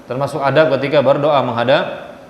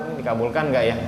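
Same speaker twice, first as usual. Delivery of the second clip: softer, lower, unsure question; doubtful muttering; 4 semitones lower, 7 dB quieter, faster than usual. Retold or imitated. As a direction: wonder aloud whether it will work. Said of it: "ragu-ragu". (ragu-ragu, suara lebih pelan dan rendah)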